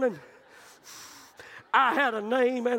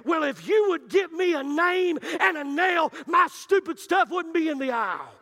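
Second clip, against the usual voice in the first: defiant shout, higher and louder; a boy snaps back at his teacher, defiant shout; talking back; 6 semitones higher, 4 dB louder than usual. Retold or imitated. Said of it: "I jumped up and I said".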